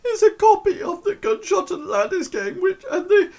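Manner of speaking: hammed-up high falsetto wail; crying. Erin crying over a sold-out game, high tearful wail. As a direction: blubber through it like a heartbroken girl. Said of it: "still sort of, like, crying".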